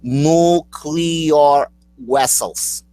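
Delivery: slow NOO-KLEE-AR for nuclear, then "wessels" for vessels; exaggerated correct nuclear. Shown as a demonstration. Host giving the supposed right way, slow nuclear wessels. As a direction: spell out nuclear in big slow syllables. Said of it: "the way you're supposed to say".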